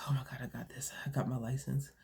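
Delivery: hushed, breathless whisper to herself; a disbelieving whisper of triumph; excited whisper. Retold or imitated. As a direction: whisper it like you can't believe it. Reliving the moment of passing.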